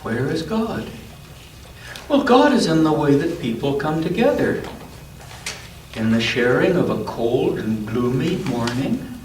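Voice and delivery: gentle storybook read-aloud; unhurried picture-book narration; reading to a child. A narrator's reading.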